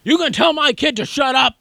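high-pitched imitation of an irate dad; hammed-up yelling parent; mock angry yell. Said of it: "he must be yelling at someone".

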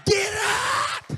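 rising to a shouted command; preacher commanding her to rise; building commanding shout. Retold or imitated. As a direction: build the prayer into a roaring command.

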